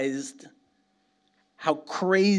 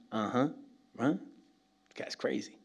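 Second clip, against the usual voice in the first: skeptical aside; 3 semitones lower, 8 dB quieter than usual. Retold or imitated. Listener's skeptical inner reaction.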